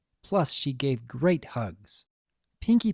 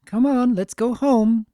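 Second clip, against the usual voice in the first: high, louder call across the park; 10 semitones higher, 7 dB louder than usual. Acted. Parent calling the foals home, high and loud. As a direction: call them home from a distance.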